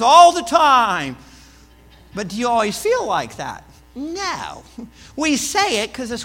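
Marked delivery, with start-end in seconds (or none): 0.0-1.2 call and response. Pretend. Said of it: "and everybody says".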